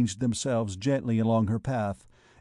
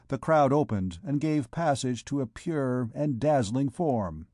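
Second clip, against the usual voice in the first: ordinary delivery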